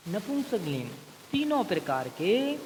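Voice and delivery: usual speaking voice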